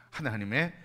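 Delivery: ordinary talk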